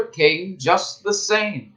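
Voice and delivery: plain reading voice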